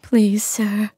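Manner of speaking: a captive selkie begging for her skin; tearful selkie woman. Acted. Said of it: "she cried".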